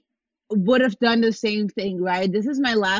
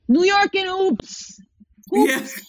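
higher and slow, stretching the names; drawn-out teasing singsong; 7 semitones higher, slower than usual. Retold or imitated. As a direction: tease like a game show host. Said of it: "he'd be like".

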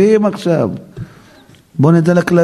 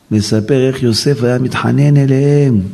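usual speaking voice

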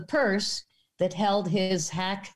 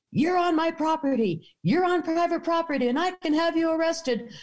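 retold angry yelling; 9 semitones higher than usual. Farmer yelling at her daughter in his field, retold angry. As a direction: imitate an irate man scolding trespassers. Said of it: "he's still yelling".

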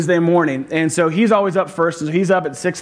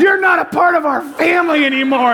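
high, louder mock yell of a little girl; angry little sister; 8 semitones higher, 3 dB louder than usual. Pastor voices his daughter yelling at her brother, high mock shout. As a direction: shout it like a fed-up six-year-old.

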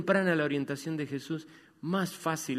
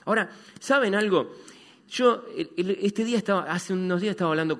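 preaching; 7 semitones higher, 6 dB louder than usual. Acted. Predica con fuerza.

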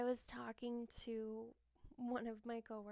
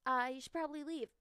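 mock cheerful dismissal; 3 semitones higher, 6 dB louder, faster than usual. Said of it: "you high five him after and you go".

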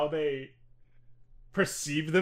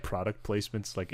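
normal talking voice

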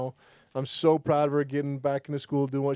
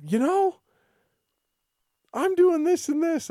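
mimicking wife; 12 semitones higher, 3 dB louder than usual. Wife quoted after shower.

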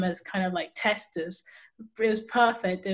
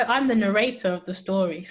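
normal talking voice

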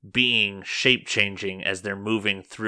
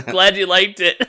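higher, louder, through real laughter; laughing; 10 semitones higher, 8 dB louder than usual. Real, not acted. GM pleased by players' reaction to finale, laughing. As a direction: say it while cracking up.

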